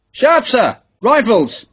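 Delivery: high-pitched military call uphill; shouted answer. A narrator's reading. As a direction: call out name and unit to a distant officer. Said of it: "shouted a question down the hillside".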